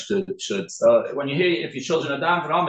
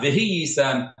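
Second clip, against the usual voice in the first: V'hiyisem drawn out, every syllable separate; slow careful pronunciation. Shown as a demonstration. The teacher shows the careful slow way to say it. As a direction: stretch out V'hiyisem slowly. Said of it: "If you say it quickly".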